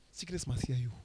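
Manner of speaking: usual speaking voice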